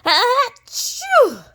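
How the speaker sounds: loud high theatrical sneeze; hammed-up achoo; big sneeze. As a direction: blast out a huge bear sneeze. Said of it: "he sneezes".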